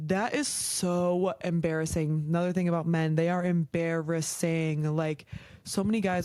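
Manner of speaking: ordinary talk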